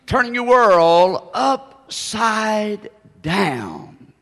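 very slow, each word drawn out; weighty, stretched-out title reading; slow title announcement. Real, not acted.

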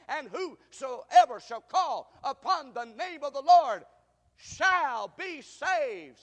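loud, high and very slow proclamation; preaching climax. Real, not acted. Preacher proclaiming salvation to the church, loud and slow.

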